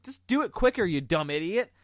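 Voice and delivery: frustrated yelling. Retold or imitated. Yelling at the T-Rex in the documentary, exasperated. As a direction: shout at a dumb T-Rex on TV. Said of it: "it always frustrated me 'cause I was like".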